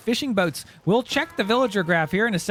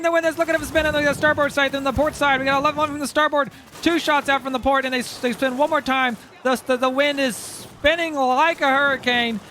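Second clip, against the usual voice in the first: excited play-by-play; 7 semitones higher than usual. Real, not acted. Hyped play-by-play of naval fight.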